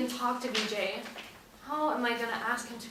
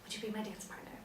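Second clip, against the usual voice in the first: quiet rehearsal; 11 dB quieter, faster than usual. Acted.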